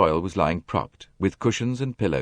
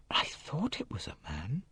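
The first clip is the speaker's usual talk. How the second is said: whispered slowly, unsure; 6 semitones higher, 12 dB quieter than usual. A narrator's reading. Jackie recalling the night for Poirot, in a hesitant whisper. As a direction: breathe it out as she remembers. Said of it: "She said slowly".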